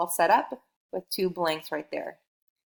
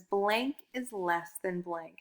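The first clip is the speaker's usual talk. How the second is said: slow, spelled-out sentence frame; slow model sentence; 4 dB quieter, much slower than usual. Shown as a demonstration. Teacher to young learner, slow sentence frame. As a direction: lay out each word for the child. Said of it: "our learning goal said".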